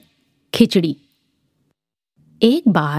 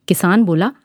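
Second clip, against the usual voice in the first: ordinary delivery, nothing marked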